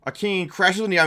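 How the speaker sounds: ordinary talk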